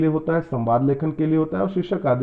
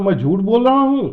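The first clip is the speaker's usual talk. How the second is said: "मैं झूठ बोल रहा हूँ" asked back in disbelief, higher and louder; questioning tone; 5 semitones higher, 5 dB louder than usual. Shown as a demonstration. Teacher showing a statement asked as a question, incredulous tone. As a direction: challenge it as a question. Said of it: "इसका मतलब वो पूछ रहा है".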